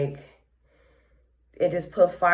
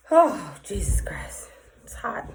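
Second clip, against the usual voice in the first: breathy whispered exclamation under the breath; a whispered "Oh, Jesus Christ" to herself; whispered aside; 4 semitones higher than usual. Real, not acted. Whispering to herself about the heat.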